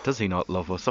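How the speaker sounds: ordinary talk, nothing marked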